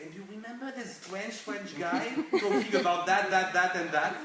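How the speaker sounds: animated mock quote. Boss imagining dinner participants talking about him, loud animated imitation.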